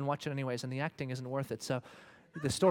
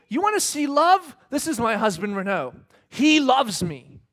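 much louder and higher; proud wife declaring; 9 semitones higher, 11 dB louder than usual. Pretend. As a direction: boast of your husband proudly. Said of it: "she will look you in the eye and say".

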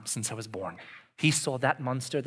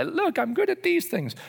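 high, loud mock bragging; hammed-up self-praise; smug boast; 14 semitones higher, 6 dB louder than usual. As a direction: play the proud know-it-all. Voicing a self-satisfied leader.

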